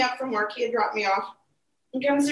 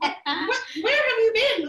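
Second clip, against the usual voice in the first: mock mad; 10 semitones higher than usual. Wife scolding her late husband, mock mad. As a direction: retell an angry demand in a raised pitch. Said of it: "and I'm mad".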